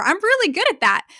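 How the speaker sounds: high, loud and proud; proud confident voice. Retold or imitated. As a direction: brag it brightly. Women saying confident things.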